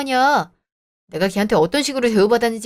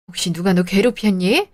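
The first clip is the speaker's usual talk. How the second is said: usual speaking voice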